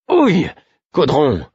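sudden high yelp of pain; pained exclamation. Acted. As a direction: yelp as the ear is pinched. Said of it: "m'exclamai-je". (cri de douleur surpris)

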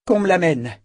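mother confessor ordering the prisoner brought in, firm command. A narrator's reading. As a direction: order it with sudden resolve. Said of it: "ordonna-t-elle".

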